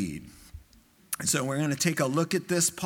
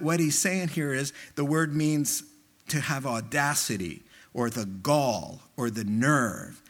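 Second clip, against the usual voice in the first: ordinary talk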